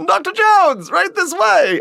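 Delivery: high eager butler voice; Dan Aykroyd impression. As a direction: usher him in brightly and politely. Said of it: "He's like".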